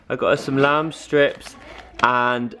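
ordinary talk

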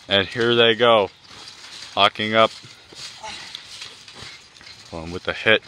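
usual speaking voice

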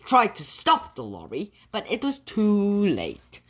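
plain reading voice

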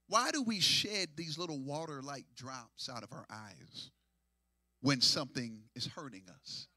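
dropped low and soft; soft teaching. Acted. Preacher quietly asking the church why God gave tears. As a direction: pull them in quietly.

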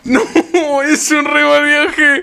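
real laughing lament, slowed down; laughing overwhelmed. Real, not acted. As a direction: drag it out, giggling and overwhelmed. Overwhelmed fan, laughing and crying.

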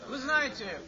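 ordinary talk, nothing marked

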